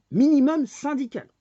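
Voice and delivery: MINIMUM syndical thrown out high and loud; mocking loud repeat. Ranting vlogger repeats the phrase to viewers, shouting.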